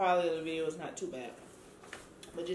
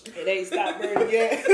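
laughing; 2 semitones higher, 9 dB louder than usual. Real, not acted. Laughing at spicy food burn.

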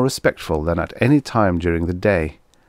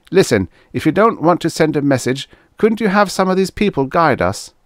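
high-pitched, louder plea; urgent appeal; 10 semitones higher, 5 dB louder than usual. A narrator's reading. Trent begs Guthrie for native guides, urgent appeal. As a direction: plead in a high, insistent voice.

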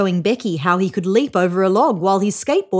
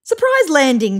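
lightly amused, higher pitch; mildly tickled character remark; chuckled; 7 semitones higher than usual. A narrator's reading. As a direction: say it with a hint of a chuckle. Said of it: "chuckled Earl Grey".

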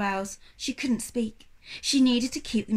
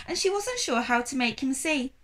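ordinary delivery, nothing marked

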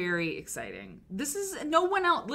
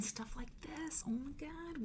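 hushed whisper; 7 semitones higher, 12 dB quieter than usual. Real, not acted. Hushed excitement.

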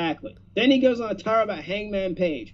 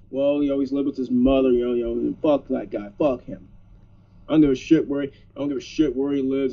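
mocking Punk; 8 semitones lower than usual. Mocking Punk's dismissive rant.

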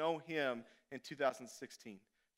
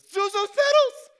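loud, high, slow ecstatic cry; joyful shout; 15 dB louder than usual. Retold or imitated. George finds the petals in his pocket, ecstatic shout. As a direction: shout it like finding treasure. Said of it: "he goes".

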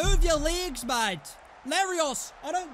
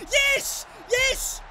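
high, loud celebratory yells; triumphant shout; 10 semitones higher, 6 dB louder than usual. Real, not acted. Player cheering a goal just scored, triumphant shout. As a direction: punch out each yes in real jubilation.

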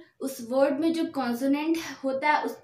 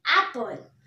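Apple with a strong first syllable; stress on first syllable; 2 semitones lower than usual. Shown as a demonstration. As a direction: lean on the opening vowel of Apple. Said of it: "बहुत ज़्यादा stress लग रहा है".

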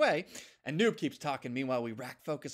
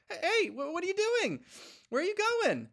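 much higher, squeaky and alarmed; startled little dog; 3 dB louder than usual. Acted. Noob calling after Chance who walks away, high squeaky alarm. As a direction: call after someone walking off.

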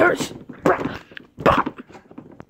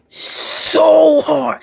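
louder, strained and whiny; strained cry; 2 semitones higher, 7 dB louder than usual. Acted. Fighter defending his training to the ref, strained cry. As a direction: push it out like a desperate plea.

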